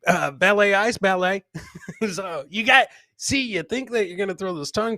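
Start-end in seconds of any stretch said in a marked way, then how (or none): none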